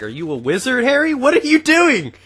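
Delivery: high, loud comic outburst; hammed-up, high-pitched exclamation; mock bewildered shout. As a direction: climb into an exaggerated yell of disbelief. Acts out his bewildered outburst.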